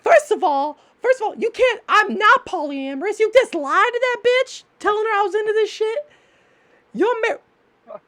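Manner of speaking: very high, indignant female nagging; bitching-out chick voice. Acted. Jealous knife wife accusing him of lying, shrieky high nagging. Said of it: "Would you rather her be bitching you out like this?".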